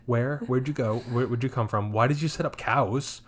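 high, rapid baffled questions; mock bewildered viewer rant; incredulous disbelief. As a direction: pile up the confused whats. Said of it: "this whole time, I'm like".

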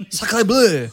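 loud, high French exclaim; French chef. Pretend.

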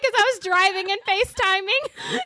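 high sheepish confession; sheepish excuse. Retold or imitated. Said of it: "I was like".

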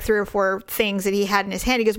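usual speaking voice

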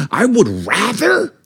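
high, slow, dramatic wail; hammed-up despair. Husband retelling his airport meltdown, hammed-up despairing wail. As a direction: overplay it like it's life or death. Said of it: "I was losing my mind".

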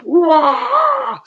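high mock martial-arts wah; imitating a karate wind-up cry; kick wind-up yell. Wrestler mimicking opponent's kick wind-up, high yell.

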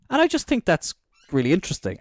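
usual speaking voice

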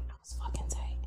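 breathy whispered confession. Real, not acted. Admitting to her old coworker, whispered. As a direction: whisper it like a secret aside.

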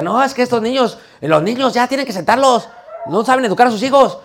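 high-pitched, hammed-up scolding; exaggerated complaining preacher. Preacher grumbling about other people's children, exaggerated and high. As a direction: imitate a preacher griping, pitch pushed way up. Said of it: "Aquel predicador era bien exagerado".

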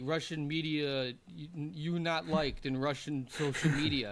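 lightly mocked Russian accent, slow and broken; a hint of a Russian agent breaking bad news slowly; slow, light Russian accent as his agent. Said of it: "he's like".

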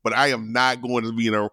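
usual speaking voice

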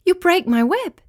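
high-pitched, alarmed protest; alarmed cry. A narrator's reading. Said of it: "cried Winky".

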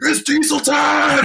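high, drawn-out mock yell; hammed-up high-pitched holler, stretched out; imitated screaming. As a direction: yell it like a goofy catchphrase. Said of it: "he just starts screaming".

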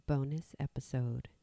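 breathy whispered tag. Acted. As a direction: whisper it like a jingle.

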